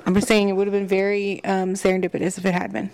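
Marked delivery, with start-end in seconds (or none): none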